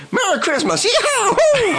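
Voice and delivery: hammed-up American cowboy cheer, "Yee-haw" whooped high; American style. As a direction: shout "Merry Christmas" like a cowboy, ending in a high "Yee-haw". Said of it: "do it in an American style".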